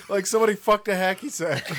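high, amused, words through laughter; giggly explanation; laughing. Real, not acted. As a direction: say it while cracking up. Laughing at misheard line.

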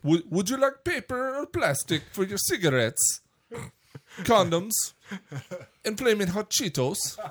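high-pitched mock store clerk; high clerk voice offering wares; gas station attendant. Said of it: "just a regular gas station attendant".

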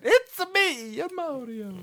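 very high, sing-out cartoon Italian; hammed-up game plumber catchphrase; Mario. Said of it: "you just talking the Mario accent".

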